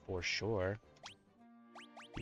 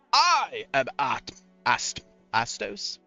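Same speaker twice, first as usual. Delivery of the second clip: slow theatrical villain menace; 3 semitones higher, 7 dB louder, slower than usual. Acted. Voicing villain, stumbling on name.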